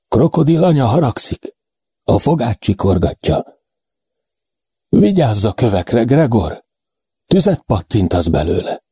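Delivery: high-pitched delirious warning; ominous fevered pronouncement; feverish, failing companion. A narrator's reading. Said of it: "A hangja érthetetlen mormogássá halkul".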